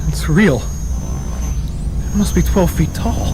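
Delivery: low, hushed wonder; breathless amazement at the light; awestruck. Acted. Man seeing the creature for the first time, awestruck.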